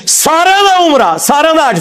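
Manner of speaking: slow, high mocking wail over the lost umrah; mock lament. Mocking the fake saint to his followers, slow high mock wail. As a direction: stretch it out in high mock grief.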